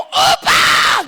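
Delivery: high, very loud call to grandpa; hollered greeting, pitched high; loud shout. Acted. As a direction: yell it out with all your might. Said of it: "kamu teriak keras-keras ya".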